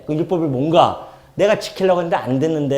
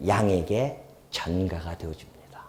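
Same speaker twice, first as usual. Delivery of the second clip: hushed whisper; 8 semitones lower, 8 dB quieter, slower than usual. Real, not acted. Preacher whispering to the congregation, slow hushed whisper. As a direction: whisper it slowly, like a secret. Secret of sin transferred to lamb.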